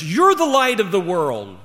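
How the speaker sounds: "You're the light" pitched high and loud; emphatic. Shown as a demonstration. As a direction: punch "You're" and proclaim the rest. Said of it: "that's the emphasis".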